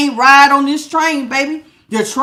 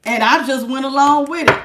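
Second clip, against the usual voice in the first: usual speaking voice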